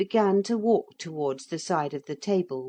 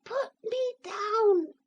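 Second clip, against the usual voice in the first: very high, slow, tiny far-off voice; small piping voice from a toy; teeny tiny voice; slower than usual. A narrator's reading. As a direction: squeak it out like a tiny wooden figure. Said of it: "said the voice again".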